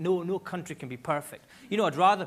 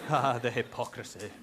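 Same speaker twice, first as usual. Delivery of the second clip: lower, mock-grand lament; mock pompous; 5 semitones lower than usual. Comedian mocking his own pretension, low and pompous. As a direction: sigh it out with sham grandeur.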